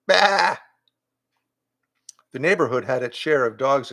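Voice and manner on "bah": loud mock goat bleat. A narrator's reading.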